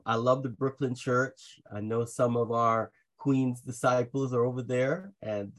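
ordinary talk

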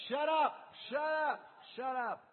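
high, loud shushing crowd, fading; angry crowd hushing a beggar; the crowd tells the blind man to shut up, sharp and loud; crowd snapping. Acted. Crowd silencing Bartimaeus.

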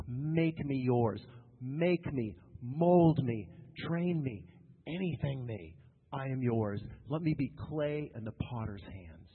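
low, slow earnest plea to God; prayerful. Acted. Preacher praying to God to change him, prayerful. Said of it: "I go to God in prayer".

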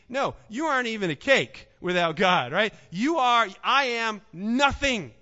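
loud, high fervent preaching; preaching at full force. Acted. Pastor preaching humility to the congregation, loud and fervent.

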